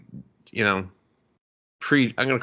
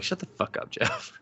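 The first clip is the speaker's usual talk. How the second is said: muttered annoyance; 8 dB quieter than usual. Retold or imitated. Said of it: "I'm like".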